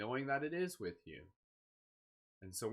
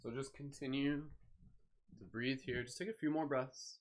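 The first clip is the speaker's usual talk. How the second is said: calm, unhurried meditation voice; guided meditation; 2 semitones lower than usual. Acted. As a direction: ease listeners gently into stillness.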